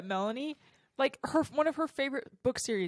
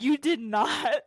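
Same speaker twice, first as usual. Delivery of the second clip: mock disbelief; 4 semitones higher, 6 dB louder, much slower than usual. Retold disbelief at taste test.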